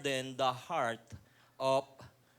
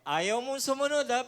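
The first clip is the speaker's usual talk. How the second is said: raised, higher, louder scolding; scolding the donkey; 7 semitones higher, 6 dB louder than usual. Acted. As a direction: yell at a stubborn donkey. (galit na sigaw sa asno)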